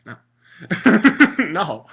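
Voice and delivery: real laughter through a scornful refusal; laughing dismissal. Real, not acted. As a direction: burst out laughing around each word. Laughing while dismissing the ruling.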